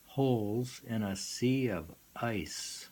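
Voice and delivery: ordinary delivery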